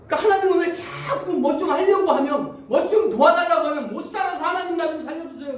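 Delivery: high, loud pleading protest; exasperated grievance. Acted. Believer asking God for help, exasperated pleading. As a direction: complain and beg in one breath.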